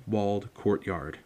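ordinary delivery

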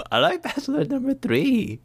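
squeaky high repeat; high mock sweet voice. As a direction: pitch it way up, teasing. Repeats choice, much higher.

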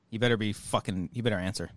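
usual speaking voice

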